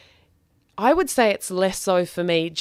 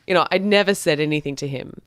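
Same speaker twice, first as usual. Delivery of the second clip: ordinary talk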